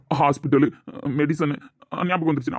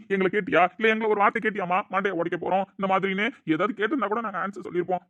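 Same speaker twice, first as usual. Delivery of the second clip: normal talking voice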